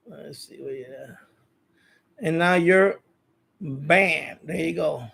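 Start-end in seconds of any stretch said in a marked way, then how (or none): none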